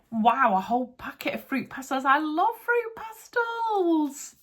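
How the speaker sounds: high, loud, stretched-out delight; excited. Real, not acted. Finding sweets in her craft box, she squeals with real excitement. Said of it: "how excited I am about some fruit pastels".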